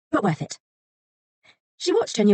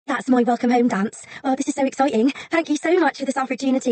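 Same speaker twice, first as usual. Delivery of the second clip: high, eager, tumbling thanks; can barely contain the joy; gushing excitement; 8 semitones higher than usual. Acted. New assistant gushing thanks to her boss, excited.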